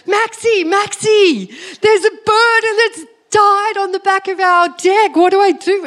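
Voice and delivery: high-pitched frantic phone call, re-enacted; hammed-up alarm, pitched right up; freaking out. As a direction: play up a panicked mum calling her son. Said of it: "I'm freaking out right now".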